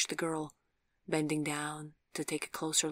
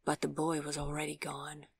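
ordinary delivery

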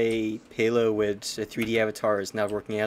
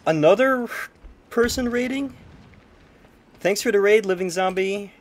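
high, loud, eager welcome; excited greeting; 8 semitones higher, 5 dB louder than usual. Real, not acted. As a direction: greet the raiders with delight.

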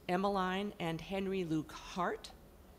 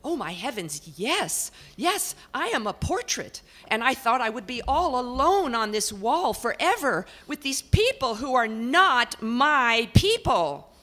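high, loud, indignant portrait voice; portrait lady; 5 semitones higher, 9 dB louder than usual. Acted. Portrait complains to the house about her people. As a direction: complain at length, put-upon.

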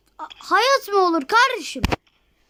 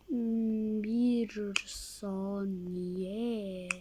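slow murmur; 8 semitones lower, 16 dB quieter, much slower than usual. Real, not acted. Pausing game, muttering.

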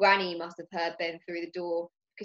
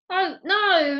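exclaiming; 10 semitones higher, 7 dB louder than usual. Acted. Boy corrects misunderstanding.

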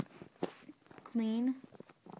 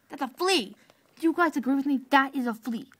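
insistent; 2 semitones higher, 7 dB louder than usual. Real, not acted. Arguing loudly with viewers.

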